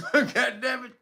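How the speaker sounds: ordinary talk, nothing marked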